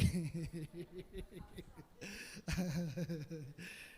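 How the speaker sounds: low, trailing chuckle; helpless giggle. Real, not acted. Preacher lost in his sermon notes, helpless giggle. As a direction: giggle on after losing your place.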